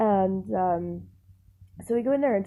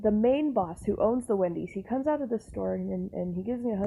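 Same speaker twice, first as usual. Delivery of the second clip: ordinary talk, nothing marked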